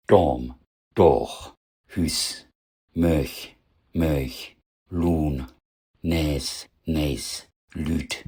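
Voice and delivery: Dorm, Dorch, Hüs, Lun, Näs, Lüt with shorter vowels and voiceless endings; typical learner mistakes. Shown as a demonstration. Teacher shows learners' common errors, short devoiced wrong forms. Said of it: "Typische Fehler".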